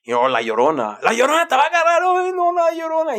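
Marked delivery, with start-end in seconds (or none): 1.0-2.0 high, louder warning in Spanish; warning parent. Retold or imitated. Parent warning voiced in story. 2.0-3.2 put-on high frightened child voice; scared child.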